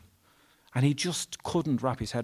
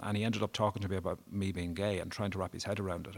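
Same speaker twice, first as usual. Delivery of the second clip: usual speaking voice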